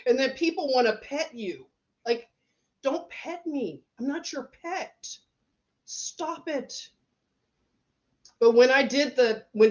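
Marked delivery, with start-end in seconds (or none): 2.8-6.9 hushed, much softer and higher mock protest; don't pet me. Fending off strangers petting her hair, soft and high-pitched. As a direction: quietly shoo off the hands, voice up high.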